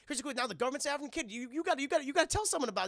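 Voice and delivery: very fast, rising panic; frantic rush. Retold or imitated. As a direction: gabble it out. Frantic rushed.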